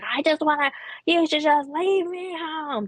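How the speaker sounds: high, strained mock yell of a drunk partner; retold yelling. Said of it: "just, like, yelling and screaming".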